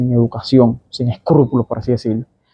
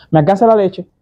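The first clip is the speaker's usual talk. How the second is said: blunt curt demand; 6 semitones higher than usual. Retold or imitated. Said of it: "estamos hablando golpeados".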